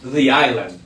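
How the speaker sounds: "the island" with THE as "dhee"; "the" with an ee before "island"; "the" as thee. Shown as a demonstration. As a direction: say "thee eye-land". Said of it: "pero se dice the".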